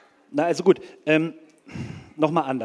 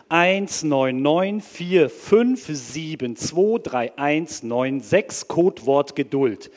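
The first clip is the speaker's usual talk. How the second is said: slow impatient dictation; 5 dB louder, much slower than usual. Acted. Impatient caller spells number slowly.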